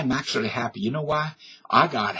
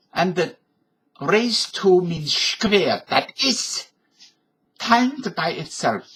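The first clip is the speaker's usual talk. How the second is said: put-on slow, higher German-accented English; Albert Einstein impression; 3 semitones higher, slower than usual. He shows viewers his Einstein explaining E equals M C squared, German accent. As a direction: explain the formula like a gentle German professor. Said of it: "here's Albert Einstein, my impression".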